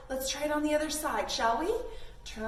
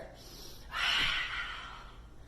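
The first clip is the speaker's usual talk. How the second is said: quick breathy exhale; 4 dB quieter than usual. Shown as a demonstration. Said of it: "go a little bit faster".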